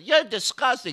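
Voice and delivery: a lightly voiced disgusted woman leaving; heckler. Heckler walking out insults Dice.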